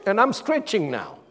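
normal talking voice

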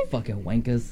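amused English accent impression; imitated English accent. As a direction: imitate a random English guy. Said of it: "speaks like that, and he's like".

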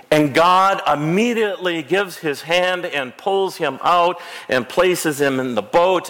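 usual speaking voice

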